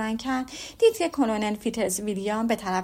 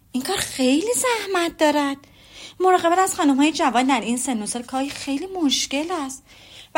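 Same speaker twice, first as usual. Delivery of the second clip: lightly voiced Elizabeth, higher and playful; teasing young woman; 4 semitones higher, 5 dB louder than usual. A narrator's reading. Elizabeth needling the Colonel about his ward, lightly playful. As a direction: tease him with mock concern.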